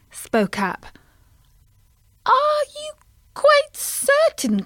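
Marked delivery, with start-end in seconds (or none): 2.2-4.6 very high, slow worried voice; high anxious mummy frog; mother frog. Acted. Tim's mummy frog questioning Colin, very high and slow. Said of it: "A frog, she was Tim's mummy".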